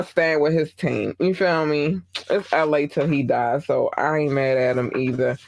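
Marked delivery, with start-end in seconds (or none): none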